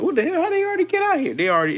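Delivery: high, loud startled cry; mock shock. A resident seeing tanks on the street, high mock shock. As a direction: squeal it like someone caught off guard.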